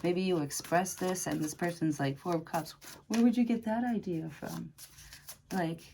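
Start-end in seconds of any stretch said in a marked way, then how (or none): 3.1-4.7 mock disbelief. Acted dismissive reply to a confession.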